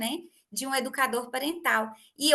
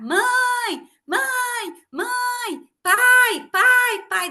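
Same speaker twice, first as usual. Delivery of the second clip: put-on much higher, louder child's cry for mum and dad; child screaming for parents; 11 semitones higher, 9 dB louder, much slower than usual. Boy alone in car at night screams for his parents, high shrieking calls. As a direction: scream mãe and pai like a frightened little kid left in the car. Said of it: "começou a gritar".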